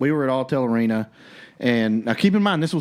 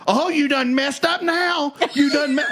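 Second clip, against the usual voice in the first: mocking girlfriend; 12 semitones higher than usual. Man imitating the girlfriend still going on, mock taunt. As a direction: mimic her carrying on, pitched way up. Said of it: "the girl goes on about".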